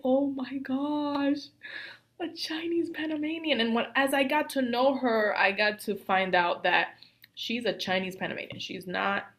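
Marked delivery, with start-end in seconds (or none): 0.0-3.6 high, drawn-out wonder; retold amazement. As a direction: act out awed discovery, pitched high.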